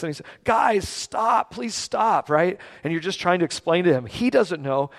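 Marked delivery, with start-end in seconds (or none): 0.4-2.3 high, lightly acted plea; mildly distressed child's appeal; begging child. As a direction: beg them like a hurting little boy. Acts out child begging them.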